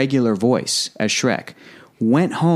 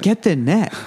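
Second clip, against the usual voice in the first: movie quote; 7 semitones higher than usual. Retold or imitated. Quoting movie line.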